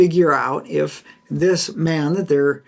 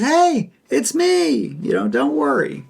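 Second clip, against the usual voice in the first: reassuring Jesus; 10 semitones higher than usual. Retold or imitated. Jesus calming frightened disciples.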